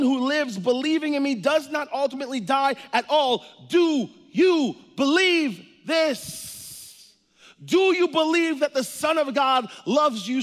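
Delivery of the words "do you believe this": drawn out, every word weighted; slow emphatic question. Real, not acted. Preacher challenging the congregation, slow and weighted. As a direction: press them for an answer.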